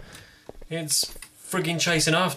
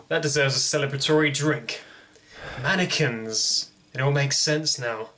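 ordinary talk, nothing marked

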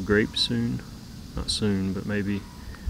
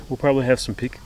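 ordinary delivery

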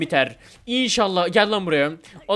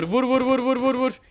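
high, rapid repeated command; urgent urging; 6 semitones higher than usual. Real, not acted. Player urging a hit on Eli Gamer, urgent. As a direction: egg them on with quick, raised-pitch bursts.

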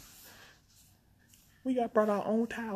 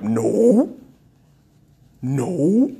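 loud, rising, drawn-out "Nope"; emphatic refusal; 4 semitones lower, 9 dB louder than usual. Pretend. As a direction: refuse point-blank with a big upswing on each "Nope". Refusing another trip.

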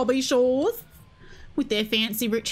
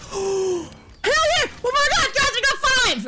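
excited cheer; 12 semitones higher than usual. Real, not acted. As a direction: shriek it out, thrilled. Celebrating kills.

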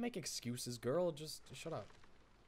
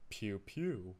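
low, soft double creature chirp; two small hushed creature cries; quiet pet-creature noise, pitched down; Cosmog; 7 semitones lower, 6 dB quieter than usual. Acted. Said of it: "that's my Cosmog voice".

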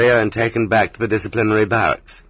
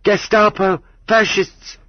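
shouting; 13 semitones higher, slower than usual. A narrator's reading. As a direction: hurl the insults at them.

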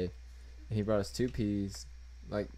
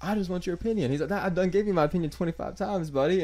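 heated mock quote; 10 semitones higher, 6 dB louder than usual. Heated retold exchange, high, loud.

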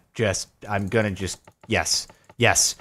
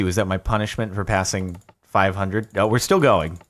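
usual speaking voice

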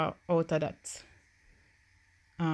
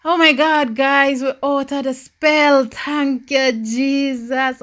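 much louder and higher, jubilant; joyful relief; 6 semitones higher, 9 dB louder, slower than usual. Real, not acted. Gamer celebrating the family's bad mood lifting, jubilant shout. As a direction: shout the good news to the viewers.

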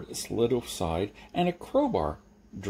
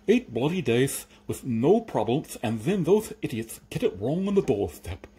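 irritated lisping creature; 4 semitones higher, 4 dB louder than usual. Acted. Crate creature to Jeremy about delivery idiots, irritated lisp. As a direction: grumble it with a thick lisp.

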